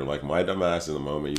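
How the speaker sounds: usual speaking voice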